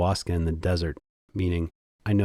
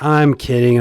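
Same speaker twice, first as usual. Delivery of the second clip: louder and higher, a quick burst; loud kidding; 5 semitones higher, 6 dB louder than usual. Real, not acted. Comic tells the audience he is joking, in a loud burst. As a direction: blurt it out to take the edge off. Joke walked back to audience.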